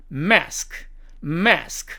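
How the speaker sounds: American "mask", front a; American demo of "mask"; American accent. Shown as a demonstration. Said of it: "미국식 발음으로는".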